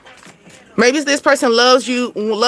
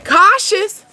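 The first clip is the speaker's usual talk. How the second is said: warning; 9 semitones higher than usual. Acted. Repeated warning, pitch peak.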